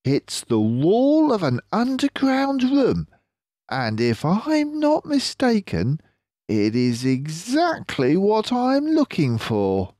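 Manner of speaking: clever fox leader, pleased with himself; Mister Fox voice. Acted.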